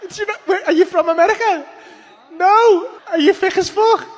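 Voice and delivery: high, giggling taunt; laughing scorn; laughing insult. Real, not acted. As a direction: sneer while laughing. Mocking a guy in a MAGA cap.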